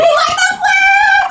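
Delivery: high, loud, slow squeal of joy; excited shriek. Retold or imitated. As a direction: scream it like you just won. Retelling her excited scream.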